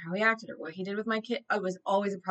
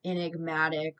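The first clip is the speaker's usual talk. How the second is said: normal talking voice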